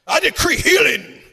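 loud, high, shouted decree; parody faith-healing preacher. Retold or imitated. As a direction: thunder it out like a hammed-up healing evangelist. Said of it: "that's why I think preachers sound like".

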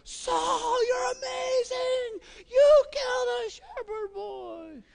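high, hammed-up cries of praise; mock cheering crowd. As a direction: squeal like a crowd cheering sarcastically. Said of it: "everybody's gonna go like".

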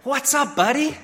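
high, put-on cheery greeting; forced cheerful. Dad pokes his head in on upset son, high forced cheer. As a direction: chirp it as cheerfully as possible. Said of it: "I ask as cheerfully as possible".

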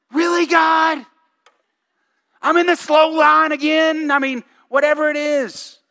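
a sulking prophet, hammed up; dramatic Jonah. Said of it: "Jonah is so dramatic".

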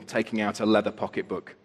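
plain reading voice

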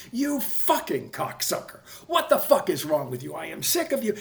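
high, furious yelled insults; reenacted scream. Screaming at the bully across the table, furious. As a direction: let the rage out at full pitch. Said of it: "I'm screaming at him".

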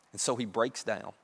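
ordinary talk

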